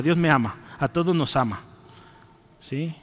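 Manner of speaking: ordinary talk, nothing marked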